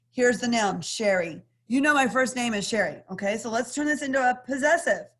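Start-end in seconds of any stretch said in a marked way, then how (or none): none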